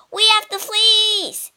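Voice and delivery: high, loud cry of victory; triumphant cry. Acted. Jason shows the crew the fleece, a high loud triumphant cry. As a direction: shout it out in triumph. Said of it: "he cried".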